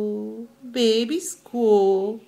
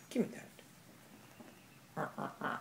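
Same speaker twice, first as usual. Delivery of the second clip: slow playful whisper to the pet; slow hushed whisper while playing; 6 semitones lower, 19 dB quieter, slower than usual. Real, not acted. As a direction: breathe it out slowly, teasing. Playful tug-of-war with pet.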